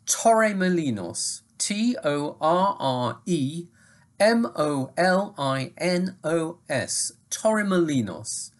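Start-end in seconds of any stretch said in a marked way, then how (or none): 1.6-7.1 spelling out. Shown as a demonstration. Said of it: "search for".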